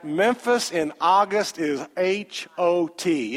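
slow, each letter of H O T said apart; spelled-out emphasis. Acted. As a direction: draw out H O T letter by letter.

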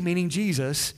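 ordinary delivery

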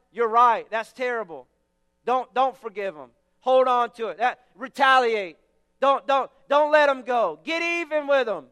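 loud, sarcastic egging on; mock encouragement. Shown as a demonstration. Preacher mocking bad pastoral advice with a loud, sarcastic cheer. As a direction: cheer them on as if hating is a good idea.

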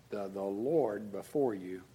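usual speaking voice

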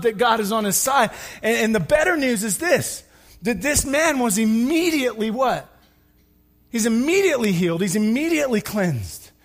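loud, high, driving sermon voice; full-force preaching. Acted. Pastor to congregation, preaching at full force. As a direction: preach it hard to the crowd.

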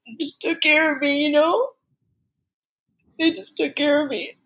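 choked up with real tears; tearful, barely holding it together; crying. Real, not acted. Tearful over family's kindness.

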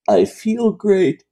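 high, slow breathy-free falsetto mock of Troi. Mocking the counselor to his cohost, high slow falsetto. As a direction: ham up the feeling empath, slowly. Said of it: "painful portrayal of women".

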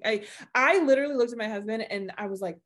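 normal talking voice

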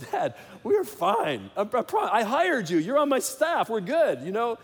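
high, loud, slow mock-exasperated reassurance. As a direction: wave off the fuss in an exaggerated whine. Son brushing off dad's worry.

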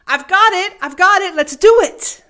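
high, loud victory shout; triumphant cheer. Retold or imitated. Manager waving the form like an Olympic gold, loud and high. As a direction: cry out like winning gold. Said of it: "waving one in the air like he just won an Olympic gold medal".

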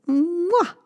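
a high, smacking kiss; kiss sound. Acted. Mother mouse kisses baby goodnight, a high smacking mwah. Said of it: "she gave him a kiss".

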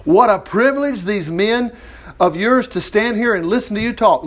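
ordinary talk

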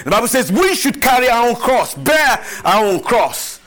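high, loud preaching to the church; full-force preaching. Acted. Pastor preaching to the congregation, full-force preaching. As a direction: preach it out, rising with each line.

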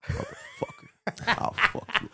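very soft, slow muttered threat; silent death-stare threat. Retold or imitated. Little girl staring down parents, hushed mock menace. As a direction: imitate a menacing glare under the breath. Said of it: "just look at you like".

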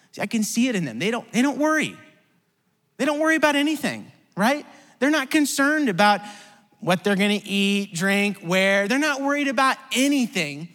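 preaching. Acted. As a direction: build the energy for the congregation. Preacher building up.

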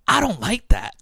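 put-on high, loud worried older woman; Joan's voice. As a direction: say it like an uneasy older lady. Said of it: "she goes".

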